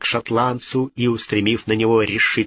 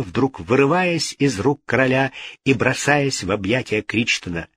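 usual speaking voice

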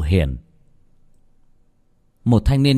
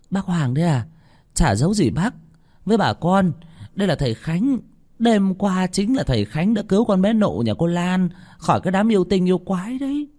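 higher woman's voice, proud and eager; eager old woman; 10 semitones higher than usual. Acted. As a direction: proudly present the young master. Bà Hương giới thiệu thầy Khánh. (giọng bà Hương cao, hào hứng giới thiệu thầy)

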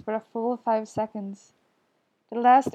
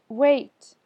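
sudden loud, higher call; urgent raised voice; 4 semitones higher, 6 dB louder than usual. A narrator's reading. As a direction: call out to stop someone leaving. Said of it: "Then Lance calls out".

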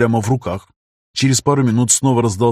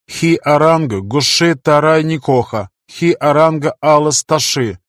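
higher, louder, slow machine speech; flat impersonal tone; 4 semitones higher, 4 dB louder than usual. Acted. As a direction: intone the alien words evenly, without feeling. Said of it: "ровным, безличным тоном".

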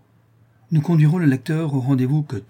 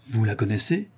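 ordinary delivery

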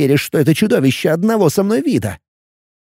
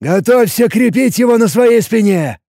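commanding; 5 semitones higher than usual. A narrator's reading. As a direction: bark it like an old sergeant. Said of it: "голосом, нетерпящим возражений".

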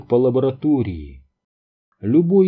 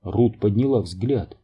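usual speaking voice

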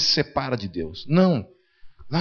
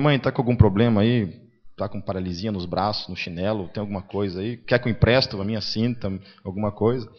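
sarcastic rebuke; 6 semitones lower than usual. Retold or imitated.

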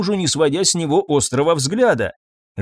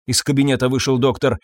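plain reading voice

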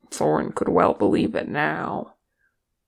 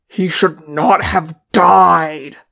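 slow, loud, growled outburst; angry growl; 8 dB louder, slower than usual. A narrator's reading. Thorin alone, railing at his companion's death, angry growl. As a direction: growl it out with force, drawing the words out. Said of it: "Thorin growled".

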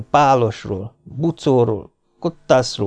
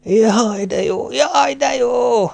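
yelling with relief; 13 semitones higher, much slower than usual. A narrator's reading. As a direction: cry out, half laughing, half in pain. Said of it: "ordította".

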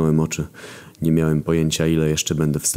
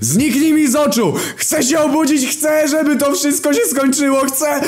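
very high, loud shouting; frantic yelling in a nightmare; desperate screaming; 4 dB louder than usual. A narrator's reading. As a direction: scream as if trying to wake up.

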